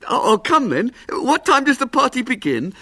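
lightly voiced hopeful showman; eager little showman. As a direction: plead for the booking.

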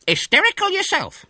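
very high, loud indignant retort; cantankerous old woman taking offence; snapping. A narrator's reading. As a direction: snap back crossly. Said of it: "snapped the owner".

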